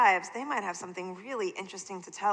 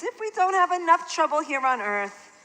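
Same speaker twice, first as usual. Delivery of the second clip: yawning aunt; 7 semitones higher than usual. Acted.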